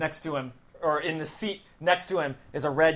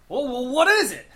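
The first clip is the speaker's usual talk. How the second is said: high, loud quizzical voice; character voice; 9 semitones higher, 6 dB louder than usual. Acted. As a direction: answer the phone eagerly. Biff answers phone in character.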